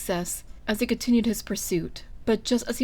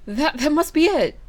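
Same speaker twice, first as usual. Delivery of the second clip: higher, louder eager gasp; breathless gasp; 7 semitones higher, 6 dB louder than usual. A narrator's reading. Basil gasping agreement to his brothers, high and loud. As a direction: blurt it out, still winded. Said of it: "gasped Basil".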